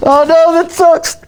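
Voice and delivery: real mortified glee; embarrassed delight. Real, not acted. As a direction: squeal at the embarrassing photo. Spotting embarrassing photo, laughing.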